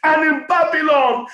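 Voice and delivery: normal talking voice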